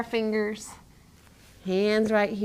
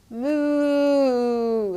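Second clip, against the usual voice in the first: slow playful moo; cow moo; 2 semitones higher than usual. Shown as a demonstration. Teacher mooing like a cow for a child in cat-cow.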